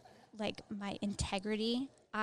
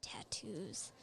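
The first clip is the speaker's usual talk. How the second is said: whisper; 2 semitones lower, 13 dB quieter than usual. Real, not acted.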